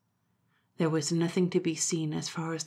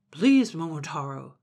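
a louder pleading plea in a changed voice; pleading dog; 6 dB louder than usual. Acted. Dog begging Momotaro to stay, pleading. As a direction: beg him not to leave you.

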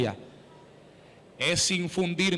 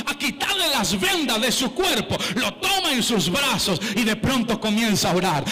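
ordinary talk

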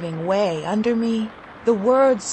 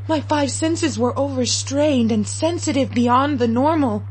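plain reading voice

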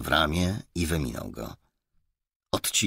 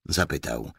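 usual speaking voice

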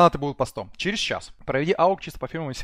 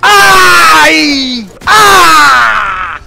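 screaming; 12 semitones higher, 17 dB louder than usual. Real, not acted. Streamer screams at game death.

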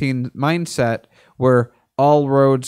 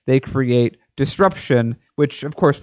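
ordinary delivery

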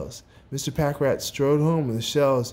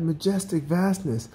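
higher, greedy chant; eager cry; 7 semitones higher than usual. A narrator's reading. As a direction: repeat it insistently in a raised pitch. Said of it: "he cried".

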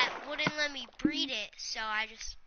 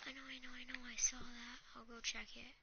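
whispering; 2 semitones lower, 16 dB quieter than usual. Real, not acted. Hiding, whispering to himself.

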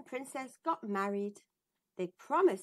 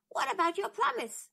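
frog voice; 9 semitones higher, 4 dB louder than usual. Acted. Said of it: "said Frog".

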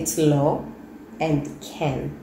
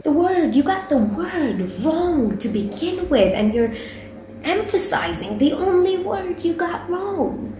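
exasperated; 11 semitones higher than usual. Real, not acted. Exasperated correction.

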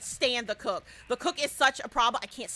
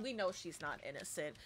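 hushed ominous aside; 9 semitones lower, 13 dB quieter than usual. Acted. Ominous aside about Sissy.

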